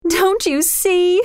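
slow, high, distressed plea; acted sob in a high girl's voice; sobbing girl. A narrator's reading. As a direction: plead slowly, pitched high in distress. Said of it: "sobbed Kismeen".

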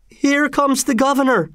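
very high, alarmed cry; fearful high-pitched call; frightened voice. A narrator's reading. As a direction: warn the crowd in a panic. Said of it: "interrupted by a frightened voice".

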